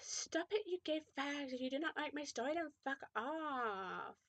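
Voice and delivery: high, half-whispered whine; scold, dragging out the last words; petulant. A narrator's reading. Author telling off readers, petulant high whine.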